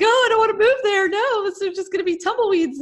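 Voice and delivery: put-on a frightened kid begging not to move; scared kid. Ten-year-old pleading with dad not to move, panicked. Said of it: "I was like".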